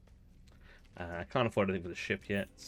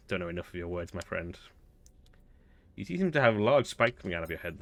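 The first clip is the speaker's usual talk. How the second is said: normal talking voice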